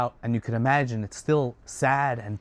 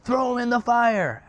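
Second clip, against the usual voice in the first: screamed order; 9 semitones higher than usual. Acted. Nimrod condemning Haran to the fire, screaming. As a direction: scream the sentence out, high and strained. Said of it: "Nimrod screams".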